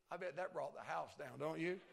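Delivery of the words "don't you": ordinary talk, nothing marked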